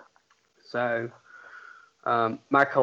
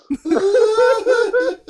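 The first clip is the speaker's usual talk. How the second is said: loud shrieking laugh; 7 dB louder than usual. Real, not acted. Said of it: "I think I broke [name]".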